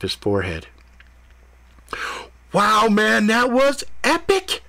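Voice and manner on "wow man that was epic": impressed cat. A narrator's reading. Cat cheering Ethan after his ordeal, high gleeful voice. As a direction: cheer like an amazed stoner cat.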